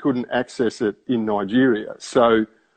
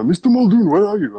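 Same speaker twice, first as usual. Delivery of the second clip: calling out; 9 semitones higher, 6 dB louder than usual. Retold or imitated. Retold phone call from assistant.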